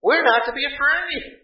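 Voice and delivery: firm preacher's reply. Acted. Pastor answering objection, repeated later.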